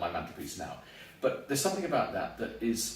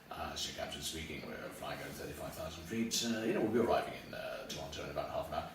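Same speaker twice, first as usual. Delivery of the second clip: low, soft, unhurried captain announcement; smooth airline pilot; 8 semitones lower, 8 dB quieter than usual. Retold or imitated. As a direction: soothe passengers like a captain. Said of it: "Very smooth".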